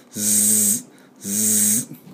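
soft long buzz like bee wings; buzzing z sound. Shown as a demonstration. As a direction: hum a quiet bee buzz. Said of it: "蜂の羽の音".

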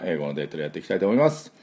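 usual speaking voice

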